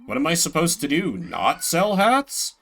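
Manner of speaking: usual speaking voice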